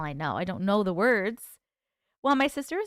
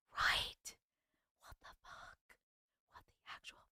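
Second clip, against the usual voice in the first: whisper; 15 dB quieter than usual. Real, not acted. Spooked by clown doll story.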